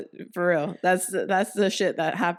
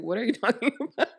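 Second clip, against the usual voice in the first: higher, quick feigned confusion with a laugh; acting dumb; 5 semitones higher, faster than usual. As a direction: play clueless, cracking up a little. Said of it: "'cause I always acted dumb".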